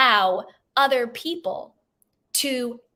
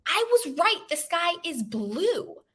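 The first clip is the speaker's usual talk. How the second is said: higher-pitched, drawn-out protest; acted protest; 6 semitones higher than usual. Lion appeals to God after punishment, acted protest. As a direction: plead the case, pitched up and slowing.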